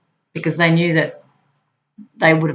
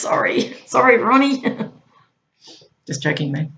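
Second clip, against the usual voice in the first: ordinary talk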